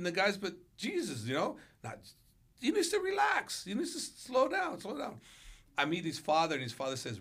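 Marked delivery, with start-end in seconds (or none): 2.6-5.2 exasperated. Retold or imitated. Coach venting about his overeager fighter, high and exasperated. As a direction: throw up your hands in the voice.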